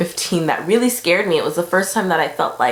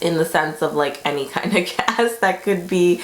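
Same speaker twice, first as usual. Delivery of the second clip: normal talking voice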